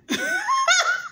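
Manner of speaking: high burst of laughter. Real, not acted. Vlogger cracking up at her own retelling, laughing.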